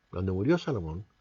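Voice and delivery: murió said low and soft. Shown as a demonstration. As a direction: drop the voice on murió Salomón. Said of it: "repeated take".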